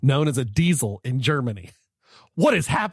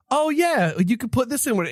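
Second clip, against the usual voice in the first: lightly voiced eager presenter, higher; high mock lady voice; 9 semitones higher than usual. Host retells a TV presenter suggesting a carbonara addition, in a light high mock voice. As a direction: chirp it like a keen TV host. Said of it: "the lady's like".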